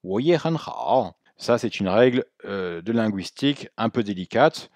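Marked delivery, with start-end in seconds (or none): 0.0-1.2 我也很好 joined, rising 我, level middle, dipping 好; only the final 好 dips fully; connected-speech tones. Shown as a demonstration. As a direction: run the phrase together. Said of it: "Si on parle vite".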